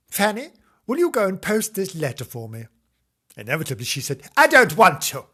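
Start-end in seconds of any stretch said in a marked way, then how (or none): 0.1-2.7 higher, lilting request; louder, pitched-up character voice; lightly voiced Missus Flange. A narrator's reading. Narrator voices Missus Flange asking. 4.4-5.2 put-on loud, high-pitched childish refusal; bratty, much louder and higher; defiant little girl. A narrator's reading. Voices defiant child Fanny refusing.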